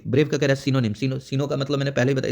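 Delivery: ordinary talk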